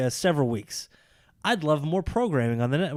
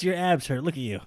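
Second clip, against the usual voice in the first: normal talking voice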